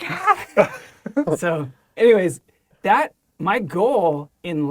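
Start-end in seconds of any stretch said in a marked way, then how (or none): 0.0-0.8 high laughing yelp; startled yelp. Retold or imitated. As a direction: yelp in giddy shock. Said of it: "and I'm like".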